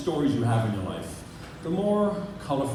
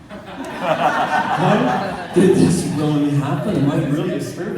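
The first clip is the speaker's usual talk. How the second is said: loud, raised-pitch rant to himself; worked-up disbelief; 4 semitones higher, 6 dB louder than usual. Retold or imitated. Teenager asking himself if he died too soon, worked up. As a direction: build a loud, comic existential panic. Alarmed questioning whether he is dead.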